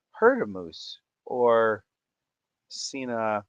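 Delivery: ordinary talk, nothing marked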